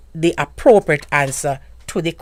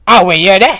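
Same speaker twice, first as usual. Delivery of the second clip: mock sassy question; 2 semitones higher, 9 dB louder, faster than usual. Shown as a demonstration. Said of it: "is not in the sense that me I say".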